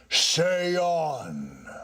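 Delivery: high, slow, lofty spirit; a solemn ghost warns him off; ghost's voice. Acted. Ghost warns the tenant about the poker, slow and eerie.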